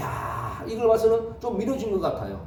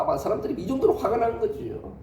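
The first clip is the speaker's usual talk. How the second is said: ordinary talk, nothing marked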